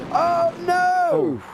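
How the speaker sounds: sudden high cry of shock. Real, not acted. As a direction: gasp it out at the crash. Crash seen live on track.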